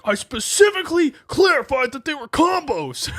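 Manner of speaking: high, loud, indignant complaint; angry customer. Retold or imitated. Customer yelling at the clerk, high and indignant. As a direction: insist furiously you were clear.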